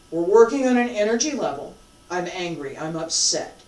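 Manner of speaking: normal talking voice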